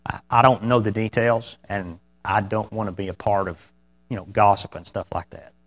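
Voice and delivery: soft, low-key calm refusal. Acted. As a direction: decline calmly and quietly, voice easing down. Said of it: "just calmly say".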